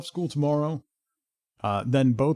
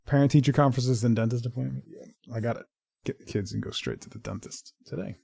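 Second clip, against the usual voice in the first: ordinary talk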